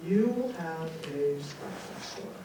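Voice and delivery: slow, hushed pronouncement from beyond; solemn voice from nowhere, falling away; big mysterious voice. Retold or imitated. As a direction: drop low and space the words out. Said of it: "this big voice goes".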